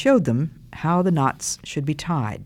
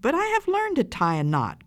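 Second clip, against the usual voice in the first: put-on high, insistent child; protesting cry; 13 semitones higher than usual. A narrator's reading. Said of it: "cried the child".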